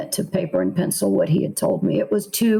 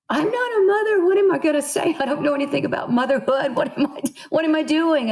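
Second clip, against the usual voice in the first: higher, frantic self-talk; retold panic; 6 semitones higher, faster than usual. Writer recalling her panic over a motherhood assignment, high and fretful. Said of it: "I remembered panicking".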